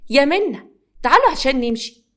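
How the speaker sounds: put-on mother calling her girls to leave. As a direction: call the daughters loudly across the flat.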